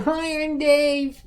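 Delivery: very high, slow, dopey greeting; imitating Dave. Mocking Dave's hello at the convention, squeaky and slow. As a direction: make Dave sound small and goofy. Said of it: "He said".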